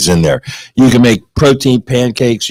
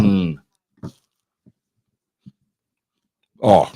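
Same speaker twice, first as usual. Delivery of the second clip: eating while talking; mouth full; 4 semitones lower, 6 dB quieter than usual. Real, not acted. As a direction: talk through a mouthful. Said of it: "you don't talk with your mouth full".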